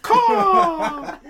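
high squawking bird cry; eagle caw. Pretend. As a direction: screech like an eagle. Eagle joke, cawing.

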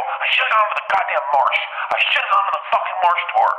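high-pitched acted exasperation; high, worked-up mock tirade; angry. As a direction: rant it in retold anger. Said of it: "I was so goddamn angry".

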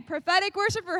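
ordinary talk, nothing marked

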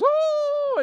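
high, loud mock wail; mock crying wail. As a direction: wail out a hammed-up cry. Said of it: "and she cries".

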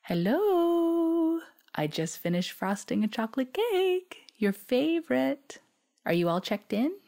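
sing-song voice. Retold or imitated. Mother answering her daughter's call in a high sing-song voice. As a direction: chirp it high and cheerful like a happy mom. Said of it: "She answered the phone in a sing-song voice".

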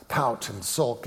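ordinary talk

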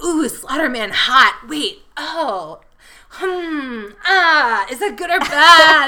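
high, hammed-up indecision breaking into laughter; dithering swiper. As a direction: act torn between thrilled and alarmed. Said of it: "takes you so long to be like".

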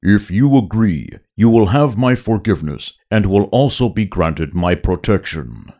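big booming Hutt voice; Jabba voice. Acted. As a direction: rumble like a giant slug boss. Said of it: "a deep second sound".

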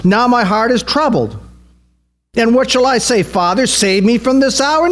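high-pitched, fervent sermon delivery; impassioned preaching. Real, not acted. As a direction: preach it with conviction, pitch lifted. Preaches with raised intensity.

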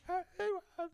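high muffled voice heard through shower noise; muffled far-off chatter. Retold or imitated. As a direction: mimic unclear talking from another room. Wife talking muffled behind shower.

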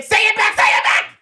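high, insistent mimicry; mock demanding. Partner on the phone wanting love returned, mock pestering. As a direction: badger the partner in an acted voice.

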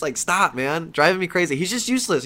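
ordinary talk, nothing marked

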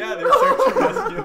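squeaking out the words through loud laughter; can barely get it out for laughing; confirming it was search and rescue, cracking up; laughing hard. Real, not acted. Laughing at own story.

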